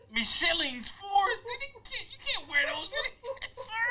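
high laughing roast. Real, not acted. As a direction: crack up mid-roast. Roasting shoes, laughing hard.

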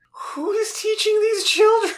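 high-pitched, laughing outcry; mock outcry. Cohost asks who is minding the school, high mock outcry. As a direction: cry it out high through laughter. Mock outrage at absent teachers.

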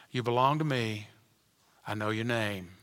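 low, soft, hushed voice of God; tender reassurance. Retold or imitated. God reassuring a suffering believer, soft and tender. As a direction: speak it like a gentle promise. God's comforting words.

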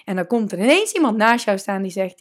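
ordinary talk, nothing marked

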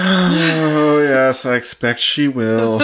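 loud, drawn-out theatrical sighing delivery; hammed-up weary sigh; heavy sigh. A narrator's reading. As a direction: sigh the whole line out slowly. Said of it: "said Bernard with a sigh".

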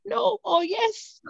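high, drawn-out retold disbelief turning to joy; stunned joy. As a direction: re-enact the amazed realisation, stretched out high. Acting out seeing positive result.

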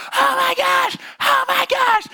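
very high, loud panicked shrieking. Retold or imitated. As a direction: shriek it like you just saw blood. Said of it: "she's like".

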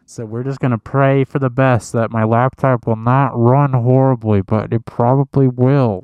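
loud, slow, hammed-up dread; loud complaint. Real, not acted. Host griping about his old laptop, loud. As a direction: moan it out loud, half-joking.

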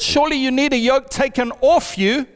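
ordinary talk

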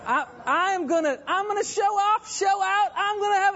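very high, excited swagger; playful bragging at a high pitch; mock show-off. Preacher pretending to show off before a miracle, excited bragging.

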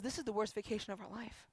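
soft, subdued retold complaint; a touch of misery, voice dropped; dejected lament. Injured woman lamenting her vacation to pastor, quietly dejected.

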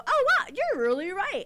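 high, loud mock gush; mock impressed listener. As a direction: gush like someone finally convinced. Imagined praise, mock voice.

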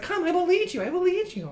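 high, solemn little guide; grand little creature leading; Gerby voice. Acted. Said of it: "Gerby's like".

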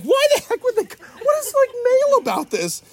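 a high, loud indignant voice; mock outrage. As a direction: play the scandalized kid. Mocking kids' outrage at plug.

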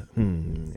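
low quiet pilot hum; deep drawn-out hmm. Retold or imitated. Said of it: "they always do the".

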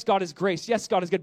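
normal talking voice

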